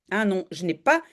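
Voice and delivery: ordinary talk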